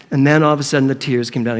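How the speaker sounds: ordinary delivery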